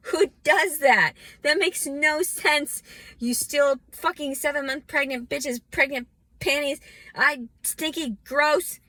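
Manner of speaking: loud, high, outraged shouting; angry rant. Real, not acted. Ranting at whoever stole her panties and hairbrush, loud outrage. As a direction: yell it out, disgusted and furious.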